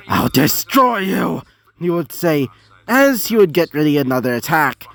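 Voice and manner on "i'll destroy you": slow, raised villain voice; villain's threat. A narrator's reading. Dogen threatens the towering Flaros, slow villainous menace. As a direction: draw out the threat in a put-on voice.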